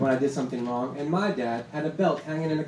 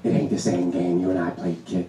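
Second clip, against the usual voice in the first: put-on much lower old-man voice; gruff grandfather; 11 semitones lower than usual. Grandfather in tracksuit scolding the narrator, much lower old-man voice. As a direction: drop low like a crusty grandpa. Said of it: "he turns around and says".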